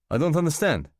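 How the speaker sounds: a lightly done Russian waiter, puzzled; Russian accent. Acted. New waiter questioning the departing guests, in a light Russian accent. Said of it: "he says, a Russian accent".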